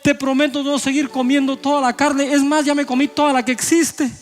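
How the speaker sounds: high, pleading voice of a sick man; sick man praying to God for healing, high and pleading; sick man begging. Acted. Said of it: "Está en un cuarto uno diciendo".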